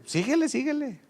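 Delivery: high-pitched call to keep going. Retold or imitated. Father urges his running son on, in a high call. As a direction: urge him on from behind.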